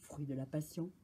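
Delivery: low, soft musing; quiet repeat. Real, not acted. Reader savours the monkey's wish aside, low and quiet. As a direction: drop down and repeat it softly.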